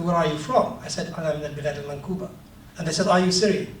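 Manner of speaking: usual speaking voice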